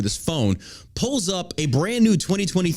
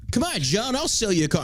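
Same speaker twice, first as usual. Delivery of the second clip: mock salesman; 8 semitones higher than usual. Mimics wheedling car salesman.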